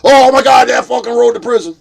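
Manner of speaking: loud, high mock alarm; Snafu Snaps. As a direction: shout it like an excited tattletale. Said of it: "Snafu Snaps run over and goes".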